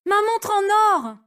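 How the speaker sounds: high shocked cry; dismayed cry. Acted. Zoé discovers watch missing. (Zoé découvre la perte, cri aigu)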